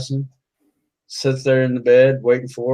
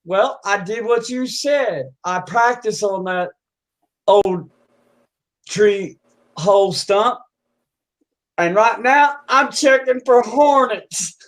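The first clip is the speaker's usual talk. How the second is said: higher, halting bumpkin explanation; hillbilly explaining himself; 7 semitones higher, slower than usual. Acted. Hillbilly justifying himself to his cousin, earnest and slow.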